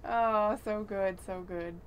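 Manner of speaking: usual speaking voice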